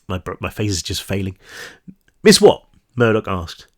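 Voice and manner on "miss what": high, loud attempt at Cockney; lightly attempted Cockney accent, pitched up. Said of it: "trying to do a Cockney accent".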